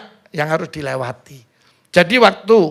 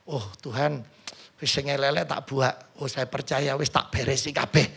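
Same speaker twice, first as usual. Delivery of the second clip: soft, lower, hushed mock prayer; mock timid prayer; 5 semitones lower, 10 dB quieter than usual. Preacher imitates a believer praying, soft and hushed. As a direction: murmur it like a half-hearted prayer.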